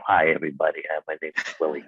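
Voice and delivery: low imitation of the uncle's voice; imitating Uncle Willie. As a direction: go low like the old uncle saying hello. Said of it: "he went".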